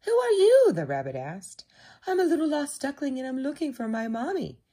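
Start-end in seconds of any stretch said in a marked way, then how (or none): 0.0-0.7 high, loud rabbit voice; questioning rabbit. A narrator's reading. Rabbit asks the strange duckling who it is, high and loud. 2.1-4.6 high, quick duckling voice; brave little duckling. A narrator's reading. Lost duckling answers Rabbit, high, quick and brave. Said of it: "said little duckling bravely".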